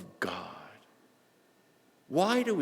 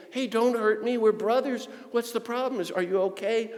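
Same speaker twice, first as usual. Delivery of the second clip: pleading appeal; 11 semitones higher than usual. Acted. Acted plea to an opponent.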